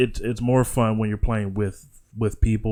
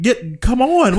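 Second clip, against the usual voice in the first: higher, urgent come on; mock urging yell; 10 semitones higher, 4 dB louder, slower than usual. Player stuck on hook yelling at teammate, higher urgent call. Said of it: "You're like".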